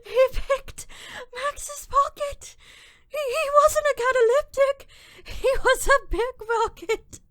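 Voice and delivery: high and loud, laughing through tears; cracking up in disbelief, near sobbing; half laughing, half crying at the absurd twist; hysterical laughter. Acted. Joe hysterical at the truth.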